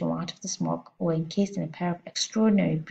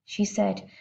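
plain reading voice

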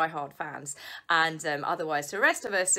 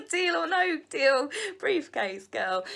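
said through laughter, high and loud; laughing; 7 semitones higher, 4 dB louder, slower than usual. Real, not acted. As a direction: giggle the words out slowly. Mocking her past TV job.

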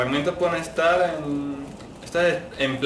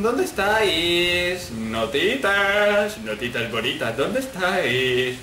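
slow cooing Notitas bonitas; coaxing singsong; 2 semitones higher, slower than usual. Pretend. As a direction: coax the notes out like kittens. Calling the notes like pets. (llamando con voz melosa y lenta)

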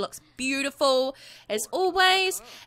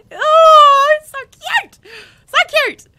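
very high, loud squeal of delight; excited squeal; 13 dB louder, slower than usual. Real, not acted. Gamer squeals at her Sims couple, very high and loud. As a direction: gush like a fan who can't contain it.

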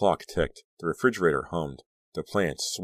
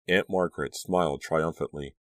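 plain reading voice

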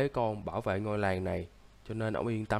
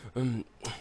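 sick cough; 3 dB quieter than usual. Acted. Sick brother in bed.

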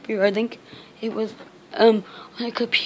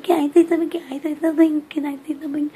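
cat voice; 6 semitones higher, 4 dB louder, faster than usual. Retold or imitated. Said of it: "my cat was like".

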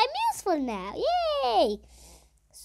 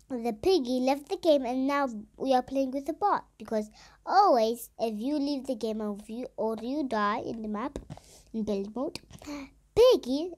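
ordinary talk, nothing marked

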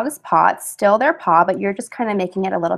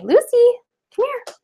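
calling the dog; 13 semitones higher than usual. Real, not acted. Coaxing her dog to come.

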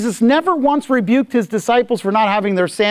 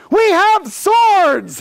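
much higher, very slow, drawn-out bluffing shout; bluffing soldiers; 12 semitones higher, 3 dB louder, much slower than usual. Retold or imitated. Preacher voicing soldiers bluffing the enemy, slow and high. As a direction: bellow the bluff to scare the enemy. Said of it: "and going".

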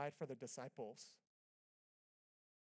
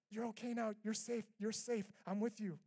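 louder, higher, urgent comforting; urgent reassurance; 6 semitones higher, 4 dB louder than usual. Shown as a demonstration. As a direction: rush to comfort them. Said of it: "It, it, it's not".

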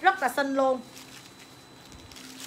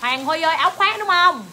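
raised, loud call to check an order; 4 semitones higher, 7 dB louder than usual. Real, not acted. Seller calls to a customer to confirm the jacket, calling out. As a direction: call out louder and higher, questioning.

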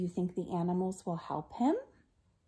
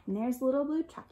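usual speaking voice

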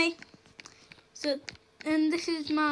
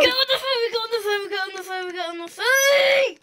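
dramatic urgent yell, rising to a cry; hammed-up shout, building to a wail; 7 semitones higher, 8 dB louder than usual. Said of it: "Bit dramatic".

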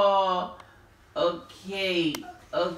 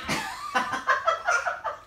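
high burst of giggling; high giggle; 4 dB quieter than usual. Real, not acted. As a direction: giggle at the silly game. Laughing at headbutt game.

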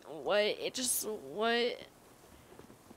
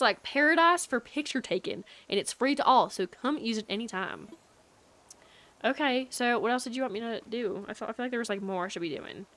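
normal talking voice

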